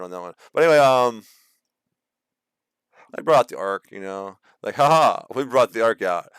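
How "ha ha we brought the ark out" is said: loud, raised triumphant crowing; hammed-up victory boast; mock gloating. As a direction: crow it like a cocky soldier. Acts gloating army with the ark.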